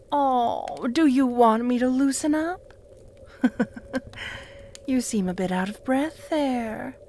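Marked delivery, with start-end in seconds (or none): 3.2-4.8 breathy laugh. Acted.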